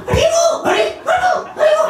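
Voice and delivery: very high, loud, shouted chant; a tiny puppy's wild ecstatic call. Acted. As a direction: cry out the names leading a crowd. Puppy leads loud kirtan.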